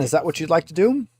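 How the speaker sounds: ordinary delivery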